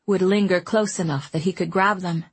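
ordinary delivery, nothing marked

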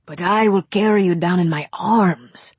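put-on ardent, emphatic young suitor; intense protest. A narrator's reading. Young Latin suitor to Nicole, protesting intensely. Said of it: "Marmora protested intensely".

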